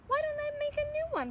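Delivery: put-on high, eager young woman's voice; eager young girl. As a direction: offer it brightly, keen to help. Briar Rose voiced, eager offer.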